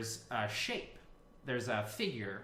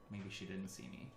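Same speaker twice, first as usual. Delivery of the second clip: much softer, hushed to himself; sneaky aside; 3 semitones lower, 12 dB quieter than usual. Acted. Robot construct hiding from the elf, softly to himself. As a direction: murmur it hoping not to be noticed.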